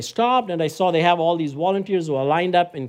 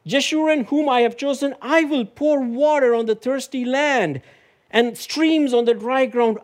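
high, ringing proclamation of scripture; fervent preaching; 10 semitones higher than usual. Acted. Preacher reading God's promise aloud, proclaiming it fervently. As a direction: declare the promise with conviction.

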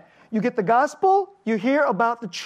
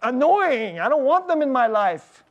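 annoyed brush-off; 5 semitones higher, 5 dB louder than usual. Retold or imitated. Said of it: "if you're one of those people".

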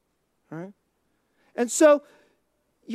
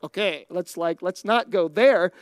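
mock recoil; 6 semitones lower, slower than usual. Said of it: "You're like".

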